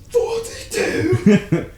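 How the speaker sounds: high, long-drawn yell; mock yell. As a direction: yell it loud, high and stretched right out. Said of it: "there's even a guy who yells".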